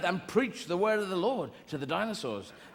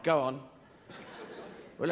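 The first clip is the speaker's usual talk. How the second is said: normal talking voice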